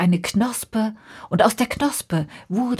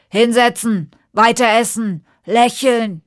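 stern command; 3 semitones higher, 7 dB louder than usual. Acted. King orders ministers.

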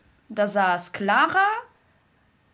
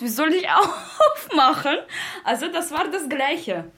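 laughing retelling; 5 semitones higher, faster than usual. Real, not acted. Woman recalling her panic at the glass doors, laughing.

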